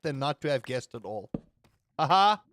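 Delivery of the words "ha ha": deliberate, separated "ha ha"; loud fake laugh; mock laugh. Streamer quoting a reference to chat, mock laugh.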